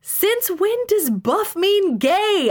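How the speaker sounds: high, slow, incredulous yell; yelling back. A narrator's reading. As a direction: yell the question right back. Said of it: "I yelled back".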